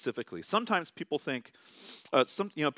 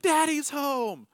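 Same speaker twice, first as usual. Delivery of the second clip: much higher, louder, slow sing-song squeal; excited little girls; 13 semitones higher, 6 dB louder, much slower than usual. Retold or imitated. Daughters greeting dad at the door, high excited sing-song.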